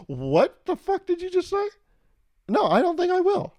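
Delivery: very high, loud scandalised squeal; mock outrage. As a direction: squeal in shocked refusal. Outraged reply to flirting MacBook.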